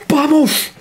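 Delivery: high, all-out cry of joy at a goal; triumphant yell; goal celebration shout. Real, not acted. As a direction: let it rip like your team just scored.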